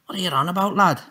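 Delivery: high, baffled hard-man voice; perplexed Tommy. A narrator's reading. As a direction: sound totally puzzled.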